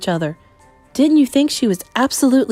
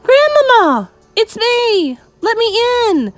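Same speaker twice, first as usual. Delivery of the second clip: very loud, high call; shouted; 14 semitones higher, 7 dB louder than usual. A narrator's reading. Boy shouting for grandmother through the door. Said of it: "I shouted as loudly as I possibly could".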